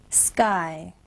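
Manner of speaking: usual speaking voice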